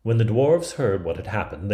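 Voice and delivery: ordinary delivery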